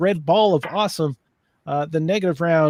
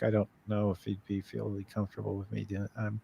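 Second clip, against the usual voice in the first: low, hushed conspiratorial aside; 9 semitones lower, 13 dB quieter, faster than usual. Real, not acted. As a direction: drop the voice so he won't hear. Hiding a joke from returning panelist.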